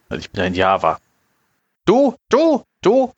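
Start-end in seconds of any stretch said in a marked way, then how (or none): 1.8-3.1 high, pushy Jawa pidgin; squeaky trader voice, broken speech; broken Basic. Acted. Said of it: "als würde ich schlecht Basic sprechen".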